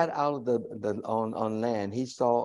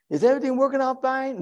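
high, quick anxious asking; hopeful question; 12 semitones higher, 3 dB louder, faster than usual. Retold or imitated. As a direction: ask it hoping for good news. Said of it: "where you're saying".

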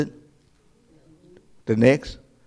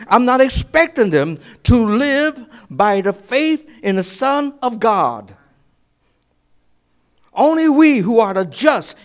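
louder, higher preaching, building to a cry on the question; preaching at full force; 6 semitones higher, 5 dB louder than usual. Acted. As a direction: preach it, climbing to a shout.

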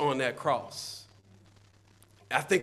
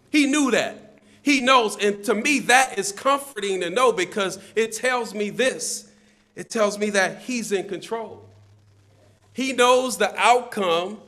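raised, punched sermon delivery; emphatic preaching; 4 semitones higher, 4 dB louder than usual. Real, not acted. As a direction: drive each declaration home to the congregation. Preaches emphatically on God's sovereignty.

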